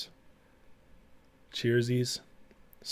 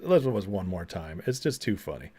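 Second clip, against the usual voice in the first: ordinary talk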